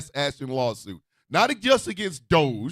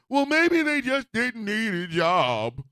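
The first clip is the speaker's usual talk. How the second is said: mocking quote; 2 semitones higher, slower than usual.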